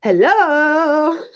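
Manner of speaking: high, drawn-out hello in a fake European accent; strange, vaguely French-sounding greeting; Don Carnage. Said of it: "they hear the voice of Don Carnage saying".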